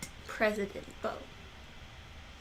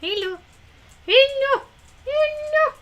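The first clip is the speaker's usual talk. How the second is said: high squeaky pet greeting; 9 semitones higher, slower than usual. Real, not acted. Greeting the dog Bo with rising squealed hellos.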